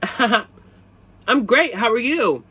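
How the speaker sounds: high, loud, mock-awkward voice; awkward nervous reply. Mocking his own nervous small talk with the clerk, high and loud. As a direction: play your own flustered answer to a crush. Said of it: "So I would say stupid things like".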